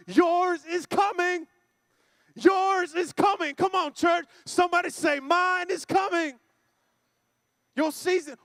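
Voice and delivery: high, emphatic preacher's exhortation; rally the church, stretching the key phrases; full-force preaching. Real, not acted.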